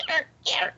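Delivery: high, soft squeaky creaks; imitated bed springs. Investigator showing how the bed springs sounded, squeaky. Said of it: "heard the bed springs going".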